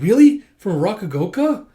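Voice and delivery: high, loud surprised burst; a sudden, loud, delighted double-take. Retold or imitated. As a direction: jump in, amazed. Character surprised at news.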